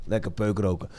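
a friend murmuring contentedly; low lazy murmur. Retold or imitated. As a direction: mumble it low and laid-back.

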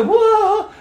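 startled laughing gasp; a high, laughing cry of alarm; laughing whoa. Real, not acted. Reacting to coming persecution.

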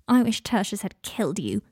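usual speaking voice

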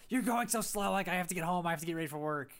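sister's impatient yell from the parking lot, mocked; imitating sister yelling. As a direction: play the rushed sister snapping from the parking lot. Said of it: "yell at me in the parking lot".

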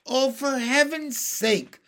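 high, slow exasperated groan. Acted. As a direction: sigh it out, fed up. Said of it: "said the earthworm".

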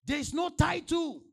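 loud, high-pitched sermon delivery; full-force preaching. Real, not acted.

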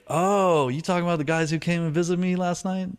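high-pitched retold "oh, I get it"; dawning realization, retold. Patient realizes she means his visitors, retold high surprise.

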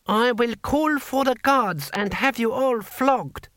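high, slow, indignant official's shout. A narrator's reading. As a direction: threaten to call the guards, outraged. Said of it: "shouted Six Beneficent Winds".

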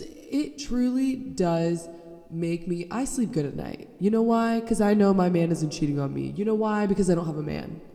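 usual speaking voice

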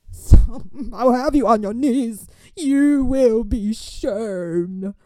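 loud, drawn-out mock threat; hammed-up warning of doom; mock menace. Warning the girl who picked a fight, hammed-up menace.

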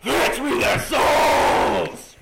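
very slow, loud, high zombie boss roar; zombie moan. Pretend. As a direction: bellow it like a game demon. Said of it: "make a Call of Duty".

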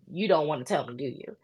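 put-on quietly teasing child; lightly voiced knowing child. Seven-year-old daughter quoted.

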